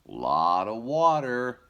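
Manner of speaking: put-on high, loud, slow little baby voice; happy baby voice. Shown as a demonstration. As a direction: marvel at it like a toddler. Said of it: "Can you say".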